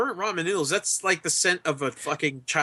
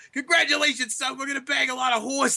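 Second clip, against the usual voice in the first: higher-pitched impression of a boastful father; proud crude dad; 6 semitones higher than usual. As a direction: play the dad congratulating his son. Acting out Ryder's proud dad.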